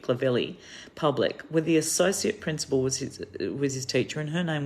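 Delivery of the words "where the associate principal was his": ordinary delivery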